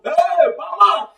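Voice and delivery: high-pitched rowdy calls to the brew seller; teacher hams up a passenger shouting from a bus window; mimicking a shouting passenger. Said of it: "this guy shouting from the bus window".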